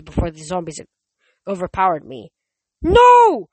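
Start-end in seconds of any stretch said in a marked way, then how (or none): 2.8-3.5 very high, full-force scream; louder shout. A narrator's reading. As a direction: shout it with everything you have. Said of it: "Nico shouted louder".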